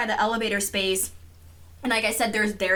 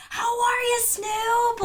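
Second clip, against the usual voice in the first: high gushing mom voice; imitating mom greeting a star; starstruck mom; 14 semitones higher, much slower than usual. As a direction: gush at him like a fan. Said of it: "she's like".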